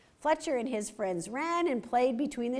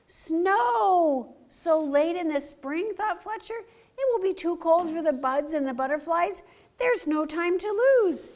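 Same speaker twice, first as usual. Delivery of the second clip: alarmed fox; 7 semitones higher, 4 dB louder than usual. Acted. Fletcher alarmed at falling snow.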